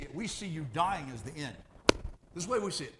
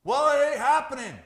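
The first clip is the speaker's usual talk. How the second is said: mock God reply; 10 semitones higher, 12 dB louder than usual. God answering the demanding prayer.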